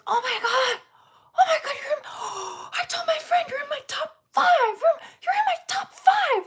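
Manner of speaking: shrieking high and loud, near tears; freaking out. Retold or imitated. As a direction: squeal it like a panicking fan. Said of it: "He's freaking out".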